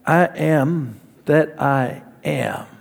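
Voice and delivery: slow, hushed divine declaration; God's answer. Retold or imitated. God answers Moses, slow, low and hushed. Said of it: "And God said".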